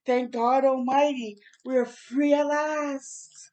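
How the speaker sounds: slow, building to loud and high like a preacher; mighty crescendo. Acted. Martin closing his speech to the crowd, preaching crescendo. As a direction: swell each phrase like a sermon climax. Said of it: "concluding with the mighty crescendo".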